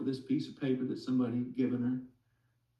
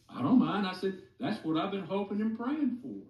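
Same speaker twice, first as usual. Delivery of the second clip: higher, eager and overjoyed; excited reply; 8 semitones higher than usual. Retold or imitated. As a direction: answer her with sudden glad excitement. Joyful reply to wife's request.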